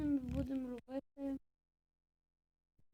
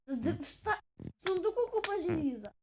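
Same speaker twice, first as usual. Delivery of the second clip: raised, higher-pitched retort; exasperated protest; 5 semitones higher, 6 dB louder than usual. Real, not acted. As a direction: push back loudly at an accusation. Protesting a complaint about iron. (обурене заперечення)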